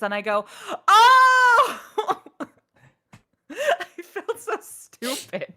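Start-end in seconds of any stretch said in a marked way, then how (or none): 0.9-2.5 much higher and louder, a startled cry; shocked cry. Real, not acted. Learning she got the role. 3.5-5.5 slow, higher, laughing. Real, not acted. Laughing at herself.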